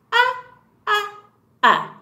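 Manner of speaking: "Ah" said as a clear open short vowel, repeated; short u sound. Shown as a demonstration. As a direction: phonics teacher demonstrating "ah". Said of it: "Letter U says".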